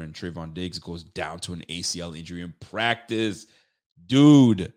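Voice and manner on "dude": a sudden loud pained "Dude"; pained outcry. Real, not acted. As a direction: blurt it out, stung by the bad news. Anguish over a star's injury.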